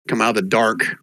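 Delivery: ordinary talk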